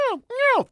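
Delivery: high, rhythmic elk cow call; three steady, rhythmic high calls. Retold or imitated. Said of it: "cadence that I threw out was meow".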